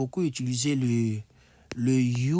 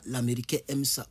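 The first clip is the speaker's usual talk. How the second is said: usual speaking voice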